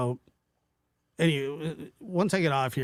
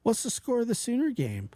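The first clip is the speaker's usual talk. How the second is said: teasing question; 8 semitones higher than usual. Retold or imitated. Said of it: "And then she'll be like".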